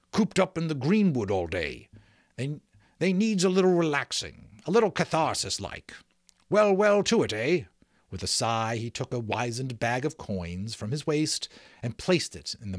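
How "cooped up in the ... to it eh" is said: lightly voiced rustic outlaw, raised and jovial; good-natured grumble. A narrator's reading. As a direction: make easygoing excuses for the boys. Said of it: "the giant grumbled good-naturedly".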